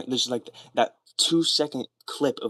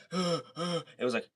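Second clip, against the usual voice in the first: higher, slow, breathy shocked gasp; mimicked shocked gasp; 6 semitones higher, 5 dB quieter, much slower than usual. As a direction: mime the wounded man's shock. Acting out shocked victim.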